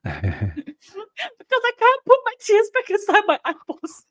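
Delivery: high, slow, laughing-crying whine. Acted. Mum's scolding answered in a high, slow laughing whine. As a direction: squeak it out through laughter and tears. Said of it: "laughing whiny retort".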